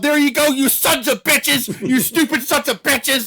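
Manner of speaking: high, loud, slow gloating taunt; mocking taunt. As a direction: jeer at the crowd, high-pitched and drawn out. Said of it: "He's like".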